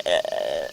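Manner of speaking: deep ugly sob; low sobbing grunt. Retold or imitated. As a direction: grunt like mid-cry over ice cream. Imitated ugly sob sound.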